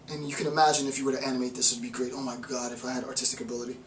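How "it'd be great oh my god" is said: ordinary talk, nothing marked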